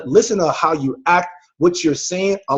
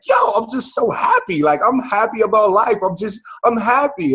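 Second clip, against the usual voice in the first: higher, lightly voiced giddy friend; Casey's gushing joy; 6 semitones higher than usual. Host imitating Casey messaging that she is so happy, mild giddy lift. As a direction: echo a friend bubbling over with happiness. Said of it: "she was like".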